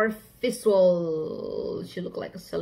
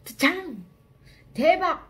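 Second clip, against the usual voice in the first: excited; 8 semitones higher, 5 dB louder than usual. Real, not acted. Excited exclamations.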